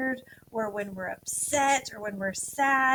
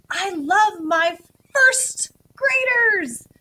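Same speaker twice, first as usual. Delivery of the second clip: slow, loud and high, bursting with love; strong emotion; 8 semitones higher, 9 dB louder, much slower than usual. Shown as a demonstration. Teacher to first graders, slow loud gushing joy. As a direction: gush it out to the whole class. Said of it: "the sentences I'm gonna model for you is".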